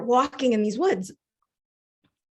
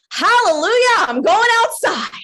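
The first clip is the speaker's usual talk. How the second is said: loud, high hallelujah cry; jubilant shout; 10 semitones higher, 6 dB louder than usual. Acted. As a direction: shout it out like you've been set free. Acted joy at lockdown freedom.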